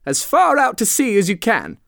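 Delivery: high, eager call; excited calling. A narrator's reading. Mock Turtle going on with the lobster dance, calling excitedly.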